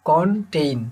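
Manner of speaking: Contain in two separate beats, কন then ten; split into syllables. Shown as a demonstration. Teacher showing students two syllables, contain split as কন, ten. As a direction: break contain after কন, giving each syllable its own beat. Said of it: "কনটেইনে দুইটা syllable আছে".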